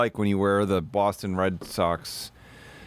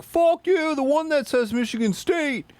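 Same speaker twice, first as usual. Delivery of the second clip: mock fan; 11 semitones higher than usual. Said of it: "They're like".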